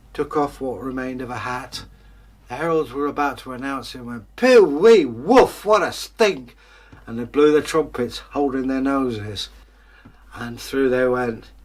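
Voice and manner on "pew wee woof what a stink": loud, slow, high cry of disgust; disgusted outcry. Acted. Heralds choking on the tramp's smell, loud and disgusted. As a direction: recoil from the stink out loud.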